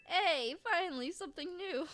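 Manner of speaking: loud, high cry of relief; delighted gamer, loud and high; excited. Real, not acted. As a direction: celebrate the new item. Pleased at new find.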